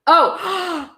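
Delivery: high, sudden "oh"; a startled oh at the text; surprised oh. Real, not acted. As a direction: caught off guard. Surprised reading ahead.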